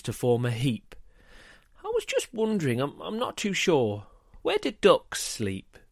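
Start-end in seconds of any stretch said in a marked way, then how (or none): 1.8-5.8 put-on high child's voice, faltering midway; hesitant little boy. A narrator's reading. John finally asking Mum his question, hesitant high child. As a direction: wonder aloud in a high, innocent voice.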